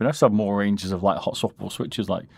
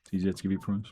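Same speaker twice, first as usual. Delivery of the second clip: usual speaking voice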